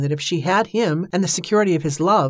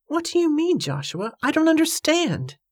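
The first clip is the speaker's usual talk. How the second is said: much higher, plaintive character voice; bewildered woman; 11 semitones higher than usual. A narrator's reading. Evangeline not understanding Joshua's anger, high and bewildered. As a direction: answer high-pitched in startled confusion.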